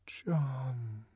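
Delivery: whispered name; voiced Lenina, whispering longingly; murmured. A narrator's reading. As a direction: breathe his name to herself. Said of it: "she murmured to herself".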